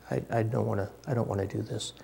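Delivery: put-on soft, reluctant mumble, like a sulky child; reluctant whine. Preacher voicing Jonah refusing God's call, soft reluctant whine. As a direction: mutter it as if you really don't want to go. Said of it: "he's just like".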